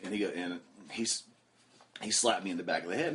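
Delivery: ordinary talk, nothing marked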